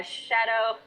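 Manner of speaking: ordinary talk, nothing marked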